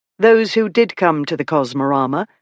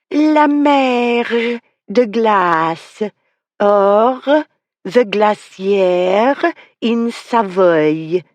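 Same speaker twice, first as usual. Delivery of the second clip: high, stretched French with heavy throaty R's; overdone French accent; 6 semitones higher, 3 dB louder, much slower than usual. A narrator's reading. The girl announcing her exhibit, overdone French accent. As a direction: announce it in hammed-up French, R's like a head cold. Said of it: "in an overdone French accent".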